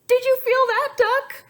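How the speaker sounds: high, loud, urgent; sensing the egg move; excited alarm. Acted. Egg about to hatch.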